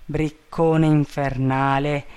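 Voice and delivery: plain reading voice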